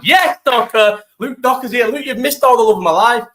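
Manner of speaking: bright excited hello to a mate; excited greeting. Real, not acted. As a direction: call out to a friend happily. Greeting friend arriving.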